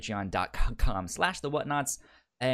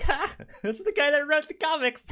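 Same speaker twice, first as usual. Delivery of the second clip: high, loud laughing exclamation; excited laugh; 4 dB louder than usual. Retold or imitated. Recognizing the comic creator's name, laughing delight. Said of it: "I was like".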